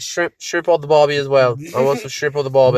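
stumbling into mock Aussie; Australian accent. Ordering at pretend Outback, jokey Australian accent. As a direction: put on a playful Australian accent. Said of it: "talk in an Australian accent".

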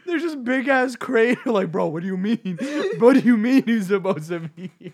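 high laughing talk; laughing retelling. Real, not acted. Friend cracks up recalling the big crater. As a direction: laugh through the whole memory.

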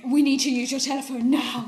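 half-laughing desperate plea for the phone; urgent demand. Retold or imitated. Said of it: "I was like".

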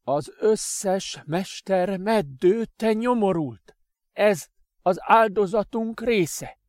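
high, loud, slow bitter outburst; furious master. Acted. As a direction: hammer home the sacrifice. Adrian's bitter revelation.

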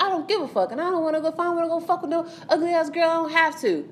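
high mocking imitation of his dismissive rant; imitating defiant boyfriend. Boyfriend snapping back at her in the car, mocking. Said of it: "He's like".

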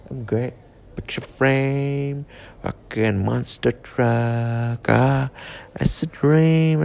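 normal talking voice

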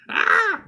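loud, very high startled shriek; startled scream. Retold or imitated. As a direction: shriek like someone caught by surprise. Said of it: "abre o Arsenio Hall e fala".

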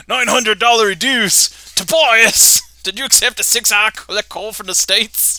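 outraged Australian father yelling at his son; angry Australian dad. Acted. Podcaster acts angry Australian dad.

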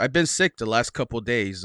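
normal talking voice

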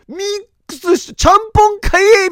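high, loud tsukkomi shout; comic retort shout. Real, not acted. Seeing the chef pour the second sauce, a loud comic retort. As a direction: call him out like a comedian.

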